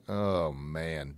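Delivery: low, soft, worn-out sigh; weary waking groan. Acted. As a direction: drag it out like a rough morning. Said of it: "it's tough to be happy some days".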